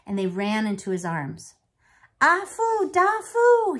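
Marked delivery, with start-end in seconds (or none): 2.2-3.8 high, loud relieved cry. Acted. Grandfather finding the lost twins, crying out. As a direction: cry out their names in relief. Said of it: "he cried".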